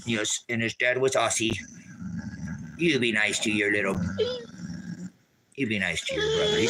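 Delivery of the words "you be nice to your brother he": high sing-song dog talk. Real, not acted. Telling the puppy to be nice to his brother. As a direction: coo at the pup up high.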